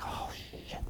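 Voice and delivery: retold whispered oh-no; whispered alarm. Doctor hears her patient struggling to breathe, whispered alarm.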